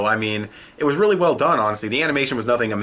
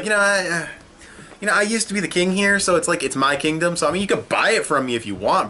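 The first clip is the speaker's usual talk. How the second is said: high, sneering villain taunt; mocking villain voice; 7 semitones higher than usual. Reviewer voicing Doflamingo taunting the king, high and sneering. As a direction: play the smug tyrant selling his kingdom.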